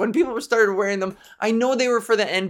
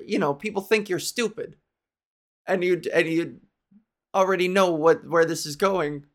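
usual speaking voice

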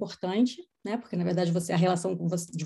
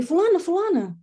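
high-pitched call to get someone's attention; re-enacted holler, pitched well up; 9 semitones higher, 4 dB louder than usual. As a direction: call a name across a busy mall. Calling across the mall to mother.

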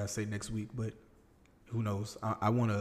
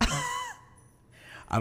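squeaky high voice; 5 dB louder than usual. Pretend. Laughing at being caught whispering.

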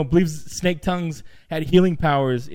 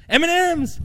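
eager answer; 11 semitones higher than usual. Retold or imitated. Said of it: "he says".

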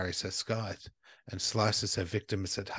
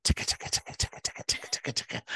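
whispered ticking; 3 semitones higher, much faster than usual. Pretend. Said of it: "So bitch be like".

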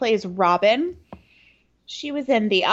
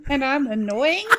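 ordinary talk

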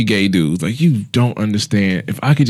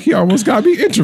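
high, giggly mock flirt; giddy flirty; 10 semitones higher than usual. Flattered by a man's compliment, giddy high coo. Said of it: "I'm like".

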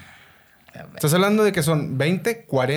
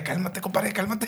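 mock calming; 8 dB quieter than usual. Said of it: "empieza a gritar".

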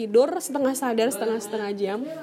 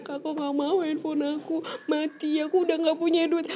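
put-on higher, childlike complaining to a father; whiny pleading prayer; 4 semitones higher than usual. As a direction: plead like a child tattling to dad. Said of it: "seperti bagaimana anak menangis kepada bapaknya". (merengek seperti anak mengadu ke bapaknya)

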